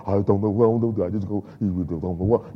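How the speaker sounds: low, mopey, helpless mumble; a hint of hopeless moaning; despairing whine. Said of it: "going to people won't be feel sorry".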